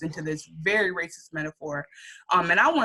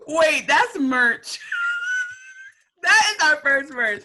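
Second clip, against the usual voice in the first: laughing excitement; 10 semitones higher, 4 dB louder, slower than usual. Real, not acted. Excited idea for merch.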